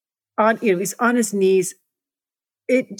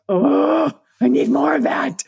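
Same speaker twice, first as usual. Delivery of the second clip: high-pitched, greedy animal craving; wild animal; 7 semitones higher than usual. Retold or imitated. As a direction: act out a ravenous wild creature, pitched up. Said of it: "I feel like this wild animal".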